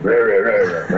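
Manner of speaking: a light mock-growl of a big devil, "Rah, rah, rah, rah"; mean devil voice. Imitating the devil voice roaring at him, mock-mean. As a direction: roar it like a cartoon devil, but only half-heartedly. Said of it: "mean like that".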